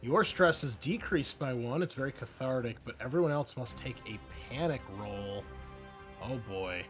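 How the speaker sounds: usual speaking voice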